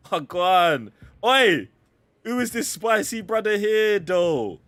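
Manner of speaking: loud, high call-out with a laugh; excited shout. Acted. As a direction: hail him loudly across the room. Hailing a game character.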